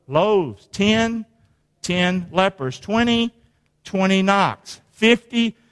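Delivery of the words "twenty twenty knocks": ordinary delivery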